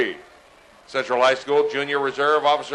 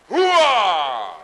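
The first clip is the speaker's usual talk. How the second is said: loud gung-ho soldier; 4 semitones higher, 4 dB louder than usual. Real, not acted. Army instructor answers the crowd.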